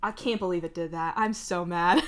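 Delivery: ordinary delivery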